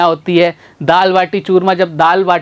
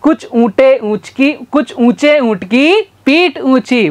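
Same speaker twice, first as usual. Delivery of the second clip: careful deliberate tongue twister; slow tongue twister; 4 semitones higher, slower than usual. Shown as a demonstration. Teacher slowly demonstrates the camel tongue twister. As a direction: lay out each word slowly. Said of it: "वापस से बोलना मेरे साथ".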